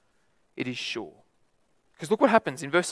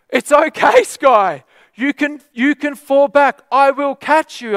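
high, loud, slow reassurance called from afar; calling out; 11 semitones higher, 9 dB louder, slower than usual. Retold or imitated. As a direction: call out the promise across the hall. Leader calling to girl again.

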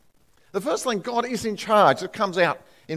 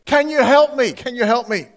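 man dangling over the cliff begging for rescue; pleading; 6 semitones higher than usual. Acted. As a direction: beg for help, clinging on. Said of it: "He pleaded".

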